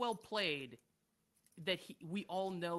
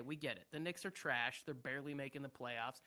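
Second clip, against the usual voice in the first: ordinary delivery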